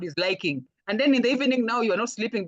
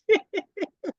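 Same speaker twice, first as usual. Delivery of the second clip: amused laugh; 12 semitones higher than usual. Real, not acted. Laughs at host's request.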